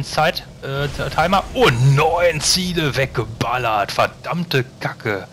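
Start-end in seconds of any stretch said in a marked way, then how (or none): 1.5-3.9 louder, slow, drawn-out triumphant call; excited triumph. Real, not acted. Nine targets destroyed at once. 3.9-5.3 stunned curse. Real, not acted. Reacting to the huge hit.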